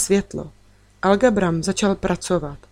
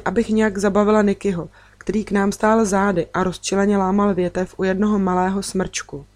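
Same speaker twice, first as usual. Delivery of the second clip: ordinary delivery, nothing marked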